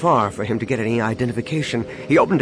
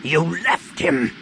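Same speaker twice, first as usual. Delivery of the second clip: slow, higher, forceful accusation; angry growl; 5 semitones higher, much slower than usual. A narrator's reading. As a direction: drag out each word in anger. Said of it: "Han said with a growl".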